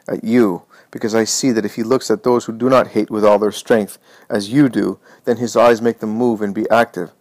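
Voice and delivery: ordinary delivery, nothing marked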